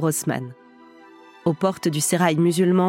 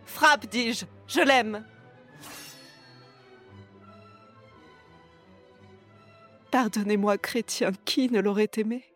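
soft, higher, intimate tragic heroine; right in your ear; 4 semitones higher, 7 dB quieter than usual. Acted. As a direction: murmur the verses close to the mic, lovingly torn. Actress recites Zaïre's verses.

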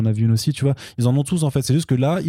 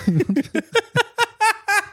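shrieking high burst of laughter; high laughing fit. Real, not acted. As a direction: let out a big high laugh.

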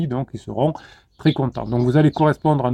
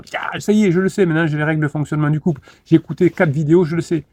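higher, hurried overconfident announcement; mock eureka; 4 semitones higher, faster than usual. Overeager learner after four videos, mock eureka. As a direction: burst out as if you've suddenly cracked it. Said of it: "en vous disant".

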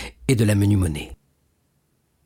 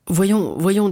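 urgent concern; 10 semitones higher than usual. Acted. Son urging fainting father.

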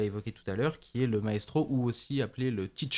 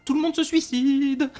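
much higher and louder, slow and mock-dramatic; mock high punchline; 13 semitones higher, 7 dB louder than usual. Punchline about the plants making everyone kill themselves, said high and mock-dramatic. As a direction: squeak it out as an absurd punchline.

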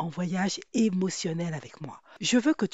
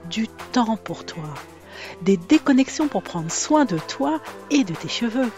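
soothing intro read; 5 dB louder than usual. Acted. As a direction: read it like a relaxing spa ad. Soothing ad-style intro over music.

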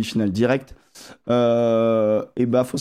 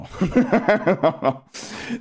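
rising giggle; high giggle; 7 semitones higher than usual. Real, not acted. Laughs mid-sentence.

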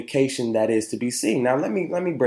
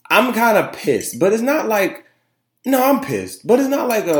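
calm pissed; 10 semitones higher, 3 dB louder than usual. Real, not acted. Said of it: "it's a calm pissed".

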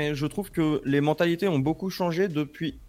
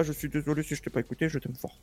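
soft tender apology; 8 dB quieter than usual. Real, not acted.

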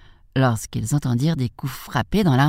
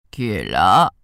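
low wolf posing as grandmother; deep, badly disguised granny call; wolf imitating granny; 8 semitones lower, 4 dB louder, slower than usual. A narrator's reading. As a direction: call out as a wolf faking an old lady, pitched low. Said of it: "imitant la voix de la grand-mère".

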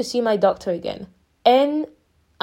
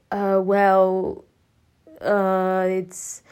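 halting, with long gaps; searching for words; much slower than usual. Retold or imitated. Patient struggling to explain to the doctor, searching for words. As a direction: imitate groping for an answer. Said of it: "searching for words".

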